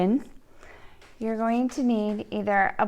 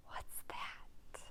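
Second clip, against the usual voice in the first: hushed, whispered question; a quiet amazed whisper; whispered wonder; 22 dB quieter than usual. Acted. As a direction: whisper as if spotting something. Pigeon sees truck.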